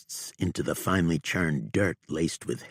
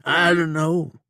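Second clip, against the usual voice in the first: horrified denial; 12 semitones higher, 8 dB louder than usual. A narrator's reading. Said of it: "panting and staring at Prophet in horror".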